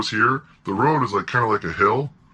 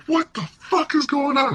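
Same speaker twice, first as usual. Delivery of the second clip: very high, frantic question; re-enacted panic. Client jumping into lawyer's car, high mock-panicked demand.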